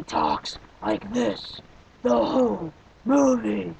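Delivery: slow, put-on Batman voice; mock the movie's Batman voice, slowly; a hammed-up Batman imitation, dragged out. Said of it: "yes, I'm gonna do the voice".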